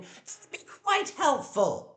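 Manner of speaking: high, slow, dripping with sarcasm; pointed sarcastic jab at the housemate. Real, not acted. Sarcastic retort in argument.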